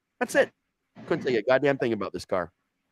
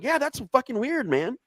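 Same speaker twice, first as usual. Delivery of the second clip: pitched way up, dazed and innocent; high stoned voice. Retold or imitated. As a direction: ask it in a high, spaced-out voice. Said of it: "I was like".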